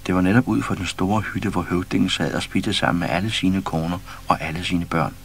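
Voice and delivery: plain reading voice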